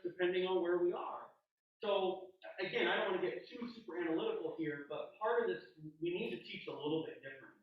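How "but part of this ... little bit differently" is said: ordinary talk, nothing marked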